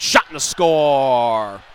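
loud, drawn-out score call; goal call. Real, not acted. Goal call in hockey broadcast.

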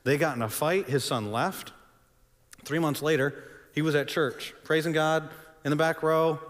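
ordinary talk

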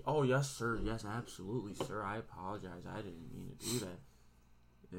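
low, soft, mock-obedient apology; meek apology. Placating the guard, retold.

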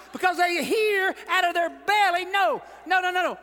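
loud, high cry of faith; defiant preacher shout; shouted declaration. Acted. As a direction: shout it out from the belly.

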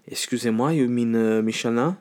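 mock French accent, hesitant correction; French waiter. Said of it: "person working in the restaurant".

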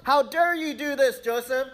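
high, loud mock outrage; outraged Potiphar. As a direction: bellow it like a betrayed master. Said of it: "So Potiphar's like".

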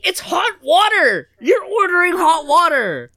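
high, slow whimpering complaint; mock crying whine. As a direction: whine it like you're about to cry. Whining about hot water.

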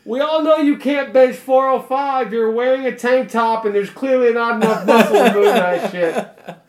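loud, high heckling; yelling across gym. Pretend. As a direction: keep hollering the accusation, laughter creeping in. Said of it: "from the back of the gym yell out".

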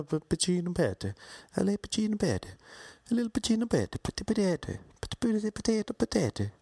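high, babbling fake Italian; mock Italian gibberish. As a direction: rattle off gibberish like a chattering girl. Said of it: "She kept whispering to the dude".

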